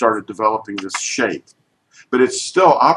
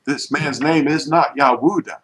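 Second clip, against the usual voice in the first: usual speaking voice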